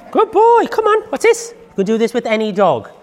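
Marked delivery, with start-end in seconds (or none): 0.1-1.5 high coaxing voice, a touch louder; dog praise voice. Real, not acted. Trainer coaxing her dog towards a toy, high coaxing voice.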